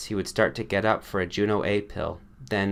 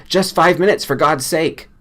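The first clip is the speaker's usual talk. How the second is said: loud, higher desperate begging; desperate pleading; 9 semitones higher, 8 dB louder than usual. A narrator's reading. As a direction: beg for just a moment more. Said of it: "the man started pleading".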